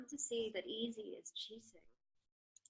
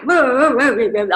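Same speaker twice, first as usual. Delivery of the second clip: loud exasperated whoas; loud angry exclamation; 2 semitones higher, 22 dB louder than usual. Retold or imitated. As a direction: vent your anger at being left. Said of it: "I was really angry".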